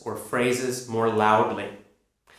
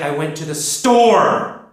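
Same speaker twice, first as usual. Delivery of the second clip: slow, with "store" very loud and long; stress on STORE; 5 dB louder than usual. Shown as a demonstration. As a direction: build to a big, loud "store". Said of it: "saying the word store very loudly".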